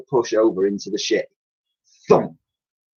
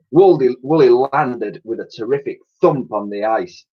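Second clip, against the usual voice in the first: ordinary delivery, nothing marked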